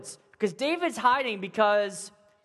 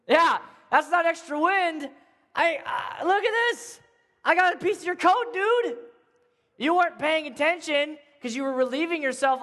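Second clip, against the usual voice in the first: raised, higher calling voice; a raised voice still calling out; calling across; 6 semitones higher than usual. Acted. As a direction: keep shouting across to Saul. David calling to Saul.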